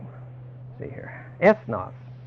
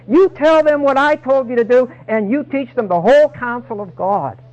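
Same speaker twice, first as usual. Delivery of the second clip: a booming Lord sending out his followers; Jesus commanding; 8 semitones higher, 8 dB louder than usual. Acted. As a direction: proclaim each order with full authority. Preacher voices Jesus commanding disciples.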